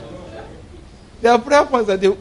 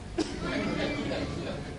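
soft laugh; 13 dB quieter than usual. Real, not acted. Preacher laughs.

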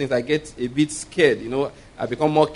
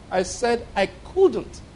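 very slow, high, measured reply; deliberate restating; 7 semitones higher, 3 dB quieter, much slower than usual. Retold or imitated. The Lord repeating himself to the doubting man, slow and deliberate. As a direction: spell out "couldn't" slowly and firmly. The Lord's retold firm reply.

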